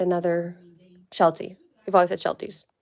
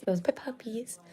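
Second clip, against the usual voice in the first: whispered and softer; 4 semitones higher, 7 dB quieter than usual. Real, not acted. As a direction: breathe it out in a whisper. Repeats to camera.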